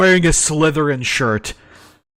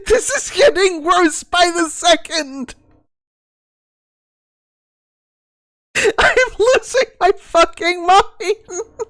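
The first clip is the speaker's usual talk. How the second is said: much higher, shouting in disbelief; exasperated yell. Real, not acted. Streamer reacting to the gauntlet mitt, high exasperated shout. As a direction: rant like you're losing it.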